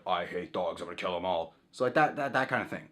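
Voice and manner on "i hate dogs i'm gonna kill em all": a lower, darker shadow-self voice. Pretend. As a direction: lower and sinister, a hint of menace. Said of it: "your shadow self's like".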